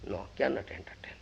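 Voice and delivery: soft whispered repeat; whispering. A narrator's reading. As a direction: whisper it, quickly.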